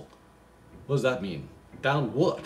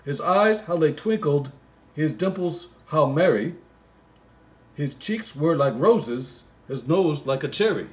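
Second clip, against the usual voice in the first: reads the Christmas poem aloud, a bit louder; poem reading; 4 semitones higher, 5 dB louder than usual. Acted. Comic reader reciting the Christmas poem, lightly sing-song. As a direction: recite the verse with a touch of storybook lilt.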